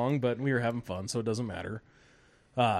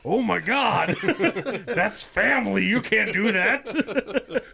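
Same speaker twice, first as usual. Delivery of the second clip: laughing disbelief; 6 semitones higher, 3 dB louder, much slower than usual. Retold or imitated. Retold shocked reaction to grandpa.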